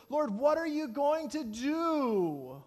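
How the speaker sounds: loud, high, drawn-out cry to heaven; desperate cry. Acted. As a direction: call out to the Lord, stretching the last word. Acted desperate cry to God.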